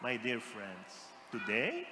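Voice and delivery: low, slow and soft; soft solemn reveal. Acted. Preacher to congregation, a slow soft reveal. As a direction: lean in and say it quietly.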